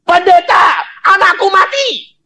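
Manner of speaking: high, loud cry of a mother; anguished shout of a grieving mother; mother's cry. Retold or imitated. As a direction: cry out to the pastor in alarm. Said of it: "Dia teriak".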